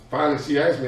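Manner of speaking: ordinary talk, nothing marked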